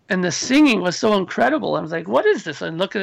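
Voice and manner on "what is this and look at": amazed question. Retold or imitated. Retelling first hearing the tape.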